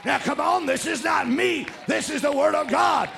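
loud, high, punched exhortation; full-force preaching. Real, not acted. Preacher insisting it is God's word, full-force preaching. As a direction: press the congregation hard.

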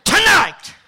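high, loud preaching call; preaching at full force. Real, not acted. Preacher promising the crowd victory, shouting. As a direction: lift it up.

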